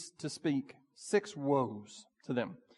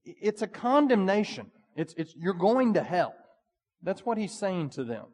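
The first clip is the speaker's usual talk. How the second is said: normal talking voice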